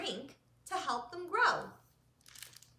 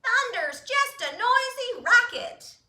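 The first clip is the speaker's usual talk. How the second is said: much higher, louder duck shout; loud quack; 11 semitones higher, 11 dB louder than usual. Acted. Duck shouts over the thunder, loud and high. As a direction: quack it as loud as you can. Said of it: "loud as he could quack it".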